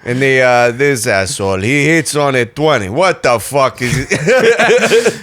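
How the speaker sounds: lightly voiced Greek grandpa; a grandpa griping about blackjack, lightly Greek; Papu's Greek accent. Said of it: "listening to Papu".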